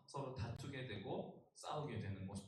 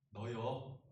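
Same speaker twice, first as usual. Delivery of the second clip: Jeolla dialect; 4 semitones lower, much slower than usual. Retold or imitated. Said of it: "전라도 뭐라고 할까요?".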